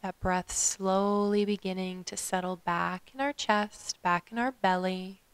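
calm meditation guide with long pauses; guided meditation. Acted. Yoga teacher guiding listeners to follow their breath, guided meditation. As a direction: lead them gently through the breath, leaving space.

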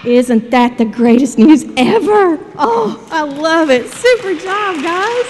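loud, delighted cheering on; real jubilation, rising high and loud; excited praise. Real, not acted. Cheers the children after their shout.

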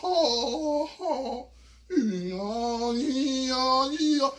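hammed-up kung fu yells; mock martial arts cries. Narrator yelling to freak out the attackers. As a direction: shout fake fighting cries.

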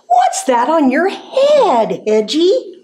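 a touch of clucky amusement; amused hen. A narrator's reading. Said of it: "she clucked and laughed".

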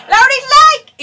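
startled shout from a stranger in a queue; shouted call. Retold or imitated. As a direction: yell it out to catch her attention. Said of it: "me gritan".

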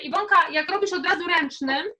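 ordinary talk, nothing marked

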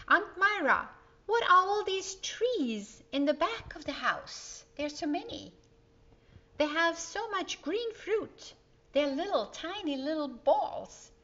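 put-on high, bright little girl asking; young girl full of wonder; child's eager question. A narrator's reading. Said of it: "asked Donna".

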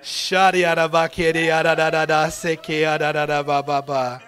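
rhythmic babble in tongues; fervent tongue-speaking; praying in tongues. Real, not acted. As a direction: pray in tongues, joyful and loud.

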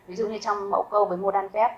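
ordinary talk, nothing marked

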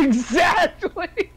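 high, drawn-out cry through real laughter; laughing agreement. Real, not acted. Player agreeing with game master's joke, laughing hard. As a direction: crack up on the word, stretching it out.

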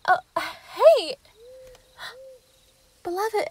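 high, loud flustered stammer; stumble, startled and guilty; caught off guard. Acted. Werewolf caught by her beloved, high flustered stammer.

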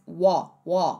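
"wo" with open aw, no L; American open O. Shown as a demonstration. Teacher showing American vowel, open "wo". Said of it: "Eles falam mais".

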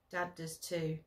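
ordinary delivery, nothing marked

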